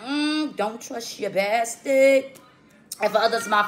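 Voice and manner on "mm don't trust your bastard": slow, drawn-out mistrustful warning; a slow, skeptical side-eye warning; wary brush-off. Retold or imitated. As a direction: drag it out like you don't trust them one bit. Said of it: "they gonna be like".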